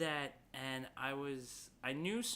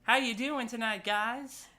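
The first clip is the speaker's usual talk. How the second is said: high, loud cheesy emcee voice; hammed-up stand-up greeting; mock comic opener; 11 semitones higher, 8 dB louder, slower than usual. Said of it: "It was".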